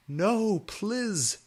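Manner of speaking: high, loud hammed-up plea; mock begging. A narrator's reading. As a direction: beg for mercy, shrill and strained. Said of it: "Lumpkin bagged".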